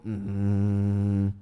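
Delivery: lowest possible closed-mouth hum; low techno bass hum. Shown as a demonstration. Teacher demos the techno bass to kids, low hum. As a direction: hit your bottom note, mouth shut. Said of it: "So you go".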